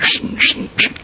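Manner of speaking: unvoiced hushing shh of a file; file sawing sound. [name] imitating the file sawing bars, hushed. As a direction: rasp it out like filing iron.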